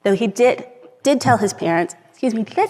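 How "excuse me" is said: ordinary talk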